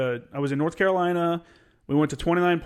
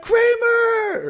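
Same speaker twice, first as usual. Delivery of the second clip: retold shout of his name, much higher and louder; surprised shout; 6 dB louder than usual. Major spotting his old mechanic, surprised shout. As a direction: call him out across the motor pool. Major recognizes him, calls out.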